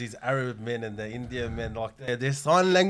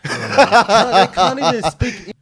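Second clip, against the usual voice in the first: laughing; 5 semitones higher, 10 dB louder, much slower than usual. Real, not acted. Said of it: "laughing at workers' English".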